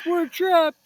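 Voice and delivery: high, loud panicked cry; Shaggy cries out trapped in the lodge; frightened cry. Acted. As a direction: wail that you're stuck. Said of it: "Shaggy cried".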